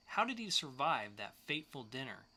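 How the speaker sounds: usual speaking voice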